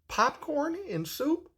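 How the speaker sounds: high, slow disbelieving question; incredulous. Retold or imitated. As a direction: stretch it out, unable to believe it. Teen hears odd instruction.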